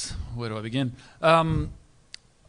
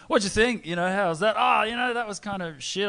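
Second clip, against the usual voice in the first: drunk punters mocking; 10 semitones higher, 5 dB louder than usual. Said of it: "from these two drunk guys".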